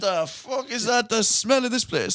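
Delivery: slow, high, appalled party guest; disgusted guest. Retold or imitated. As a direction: recoil at the stink. Guests react to the shed smell.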